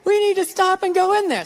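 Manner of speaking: high, drawn-out squeal of a put-on woman's voice; mock her slow, high-pitched thrill; gushing excited woman. Woman spotting a clothing store to her man, high mock gushing.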